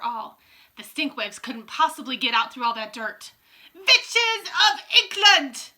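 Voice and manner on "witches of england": very slow, high, loud summons; Grand High Witch shouting. A narrator's reading. Witch queen summoning the assembled witches, booming. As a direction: stretch it out like a ruling witch calling her coven. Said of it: "shouted the Grand High Witch".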